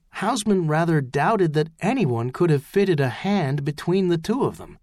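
ordinary delivery, nothing marked

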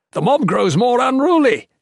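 high, loud urgent shout. A narrator's reading. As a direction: warn loudly over the din. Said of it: "Cardean shouted".

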